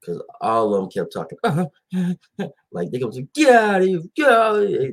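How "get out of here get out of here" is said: loud, high mock New York accent; a hammed-up New York heckle. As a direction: bark it like a New York fan. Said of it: "the New York accent".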